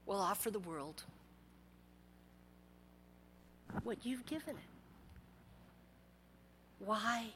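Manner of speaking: soft, low, near-whispered sermon; intimate preaching. Acted. Pastor urging leaders to know their purpose, hushed preaching. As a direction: draw them in quietly.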